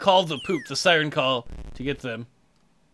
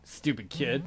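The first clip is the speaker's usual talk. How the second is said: usual speaking voice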